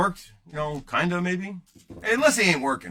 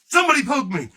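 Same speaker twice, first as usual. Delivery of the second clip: playful shout; 11 semitones higher, 7 dB louder than usual. Real, not acted. Begging viewers to poke him.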